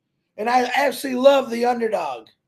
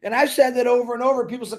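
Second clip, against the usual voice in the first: ordinary talk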